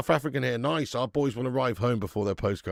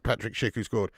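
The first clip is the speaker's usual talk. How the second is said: ordinary talk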